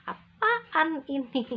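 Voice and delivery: high, loud scornful question. Retold or imitated. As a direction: sneer at the drawing. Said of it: "Jangan pernah".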